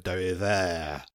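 dérivé said slowly in a mock French accent. Shown as a demonstration. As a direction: draw out Dérivé, French style, two syllables. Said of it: "I can say it with a French accent".

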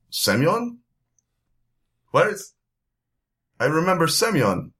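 high, dazed calling for Semyon; lost and bewildered, reaching for a name; confused vampire. Acted. GM voices confused vampire victim.

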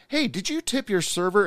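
lightly voiced owner, high and casual; breezy hey to a friend; casual phone call. Owner calls a friend on speaker, lightly voiced and casual.

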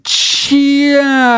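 drawn-out, high China; slow motion. Retold or imitated. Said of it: "He says it like it's slow motion".

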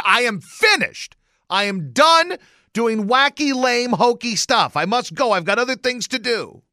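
hammed-up high-pitched declaration of quitting; emphatic protest. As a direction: insist loudly that you are through. Swears off the silly rhymes.

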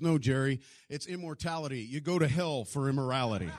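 correcting him loudly before everyone; loud correction. Retold or imitated. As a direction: pastor correcting Jerry: loud, stern. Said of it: "He says".

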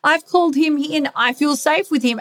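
high, bright, eager yes to surrender; the mind cheerfully talking itself into it; eager inner voice. Acted. The mind insisting it feels safe with partner, high and eager. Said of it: "And I can say, like".

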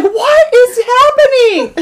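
high, drawn-out what is happening; amazed disbelief. Retold or imitated. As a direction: relive the shock of the drop. Retold reaction on elevator ride.

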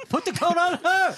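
high loud shout while laughing. Real, not acted. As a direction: yell it mid-laugh.

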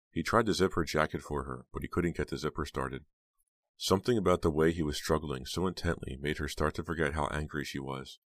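ordinary delivery, nothing marked

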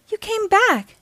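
put-on rescued girl to friend, high and thrilled; overjoyed. Lilo rescued by Stitch.

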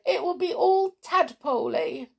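high, tearful storybook whine; weeping. A narrator's reading. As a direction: lament it high and loud, hammed-up. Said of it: "she wept".

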